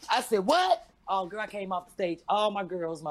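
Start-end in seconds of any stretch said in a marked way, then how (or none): 0.5-0.9 high, loud disbelieving yelp; shocked. Retold or imitated. Comic hearing from security that a crowd waits outside, shocked. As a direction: bark it out in disbelief. Told people wait outside.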